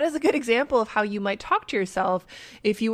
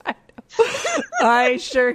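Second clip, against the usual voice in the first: laughing; 3 semitones higher, 5 dB louder, slower than usual. Real, not acted. Laughing over the name.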